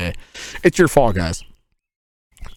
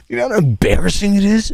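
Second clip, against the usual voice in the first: usual speaking voice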